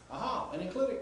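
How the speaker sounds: high-pitched aha of discovery; mock realization. Teacher playing a student who spots an enclitic, mock realization.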